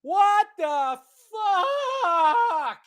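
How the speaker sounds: high, drawn-out shocked cry; stunned high shout; shocked yell. Retold or imitated. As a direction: yell out in disbelief. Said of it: "shot out of the chair and went".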